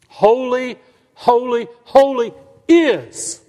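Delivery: slow, swelling proclamation; proclaimed holy. Acted. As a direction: call it out like the angels' cry. Proclaiming the seraphim's threefold holy.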